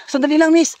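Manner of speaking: shout. Real, not acted. Calling after the woman.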